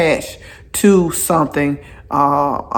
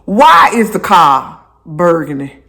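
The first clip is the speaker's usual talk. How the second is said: ordinary delivery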